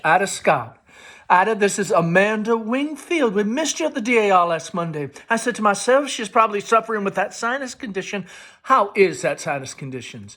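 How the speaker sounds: high, bright-pitched Southern society chatter into the phone; Amanda on the phone. A narrator's reading. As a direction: gush to an old friend on the telephone. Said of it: "Amanda enters with the telephone".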